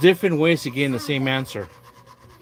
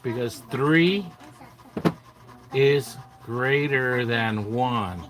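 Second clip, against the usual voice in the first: slow teaching voice; much slower than usual. Shown as a demonstration. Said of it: "teaching a child slowly".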